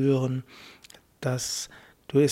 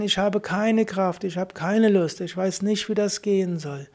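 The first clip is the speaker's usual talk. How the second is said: raised pitch to acknowledge how you feel; high voice; 4 semitones higher than usual. Shown as a demonstration. To yourself, acknowledging no energy, in a high voice. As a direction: say it higher, naming the tiredness. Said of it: "In der hohen Stimme kannst du anerkennen".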